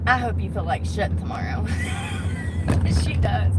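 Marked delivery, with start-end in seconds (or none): 1.7-3.0 very high cackle; gleeful squealing laugh. Real, not acted. Wishing the customer a bad hangover, high cackle.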